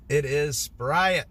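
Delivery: slow, insistent SPRY-it for "Sprite"; odd Sprite pronunciation. Real, not acted. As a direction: draw "Sprite" out into two syllables. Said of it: "I've always said Sprite".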